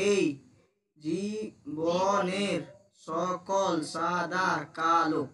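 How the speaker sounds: very slow, each word drawn out; slow syllable reading. Shown as a demonstration. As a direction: read the line slowly, word by word. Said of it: "আরো উচ্চারণ করবি না".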